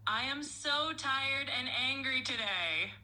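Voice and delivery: a polite voice attempting a shout. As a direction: try to shout but stay controlled. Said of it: "can you please shout and be like".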